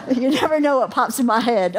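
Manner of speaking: giggling through the line; real amused giggle; laughing. Real, not acted. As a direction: laugh as you say it.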